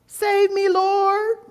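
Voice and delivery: very high, squealed mock plea; hammed-up helpless squeal for help; scared cry. Fisherman Peter forgets he can swim, mock panicked. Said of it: "he kinda gets scared".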